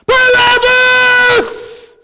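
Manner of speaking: a long, very high, loud called-out name; loud yell. Retold or imitated. Crowd calling for the prisoner, long high yell. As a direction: howl the name across the prison. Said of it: "I heard a loud yell".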